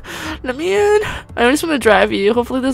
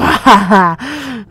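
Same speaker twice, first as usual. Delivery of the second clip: laugh; 3 semitones higher, 6 dB louder than usual. Real, not acted. Gamer amused by the car choice, laughing. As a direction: crack up out loud.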